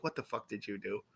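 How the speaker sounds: very quiet, low mock demand; low suspicious dread. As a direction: ask it under your breath, imitating a wary friend. Retelling the suspicious friend's question.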